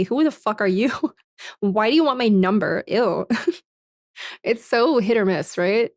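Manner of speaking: usual speaking voice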